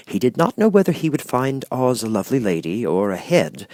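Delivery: usual speaking voice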